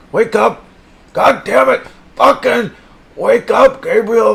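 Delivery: high, loud furious shouting; shouting at a pet fish to come back to life; angry yell. Acted. Yelling at the dead fish.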